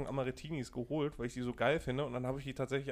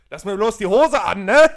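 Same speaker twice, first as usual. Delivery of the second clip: loud, high laughing yell; mock shouting; 7 semitones higher, 17 dB louder than usual. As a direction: yell it across the room, laughing. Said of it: "du die dann die ganze Zeit so anschreist".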